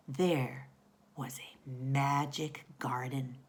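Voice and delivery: whispered, slow and soft; storytelling whisper. A narrator's reading. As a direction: hush it like a secret. Storyteller hushes for magic.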